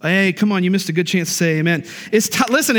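usual speaking voice

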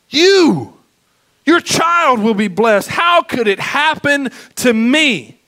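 high, loud, exultant shout; shouting Elizabeth's blessing over Mary; joyful praise. Acted. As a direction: proclaim it with overflowing joy.

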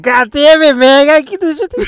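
high, loud strained hammed-up crying voice; hammed-up strained voice holding back sobs at Schindler's List; mock fighting tears. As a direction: choke back fake tears. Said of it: "I was like".

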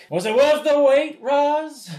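hammed-up high smug question to Roz; high nerdy voice. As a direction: squeal it out like a smitten geek. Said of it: "he's like".

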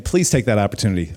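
ordinary delivery

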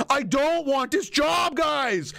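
high, drawn-out mock pleading; exasperated politician. Reluctant leader begging his angry base, mock anguished whine. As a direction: wail it as a man desperate to be fired. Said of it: "his base just gets more and more angry".

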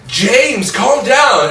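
high, stretched cry to calm someone; imitated pleading call. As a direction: call out as the worried onlookers. Retells everyone calling out to James.

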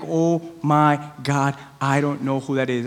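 slow, low, quiet exasperation; mock frustration. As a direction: act quietly stumped, dragging out the words. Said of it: "you just get so frustrated".

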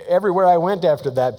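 normal talking voice

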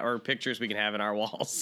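normal talking voice

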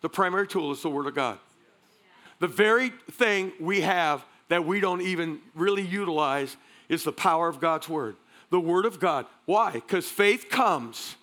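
ordinary delivery

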